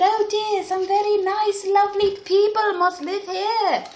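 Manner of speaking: high-pitched English princess; English accent. A narrator's reading. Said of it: "her accent had changed because now they had arrived in England".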